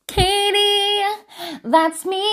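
high, drawn-out sing-song; playful sing-song. Pretend. Admitting she is the room filler, playful sing-song. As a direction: own up in a teasing chant.

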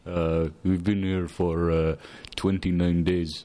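put-on low, slow Indian-accented delivery; hesitant answer in a put-on Indian accent. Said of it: "makes me speak with an Indian accent".

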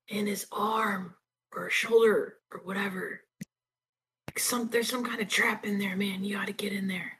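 soft unvoiced whisper. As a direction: hush it, barely voiced. Whispering to party member.

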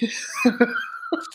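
high, airy silent cackle; breathless giggle at the milk joke; high breathless laugh. Real, not acted. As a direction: wheeze with laughter. Cracking up at joke.

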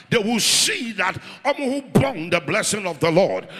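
building from firm to loud preaching. Acted. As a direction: climb steadily into a loud preach. Sermon rising in intensity.

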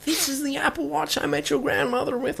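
put-on high, soft old-man voice; future grandfather. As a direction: reminisce as a doddering grandad. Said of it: "when we grow up".

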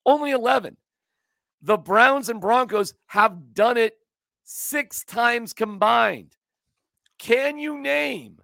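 slow, deliberate stat delivery; slow emphatic. Real, not acted. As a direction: spell it out slowly to stress it.

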